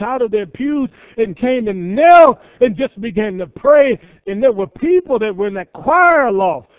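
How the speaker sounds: loud, rising sermon build; preaching at full force. Acted. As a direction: drive it home to the congregation. Preacher builds to revival climax.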